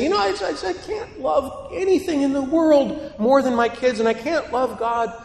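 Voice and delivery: high-pitched imitation of an objector; mock protest in a high voice; protesting parent. Voices people objecting to the teaching.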